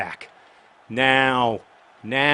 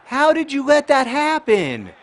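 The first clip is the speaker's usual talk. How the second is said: yelling high and loud at the screen; furious, high-pitched outburst at a blown pass; angry shout; 14 semitones higher, 10 dB louder, slower than usual. Real, not acted. Goal chance missed in the game.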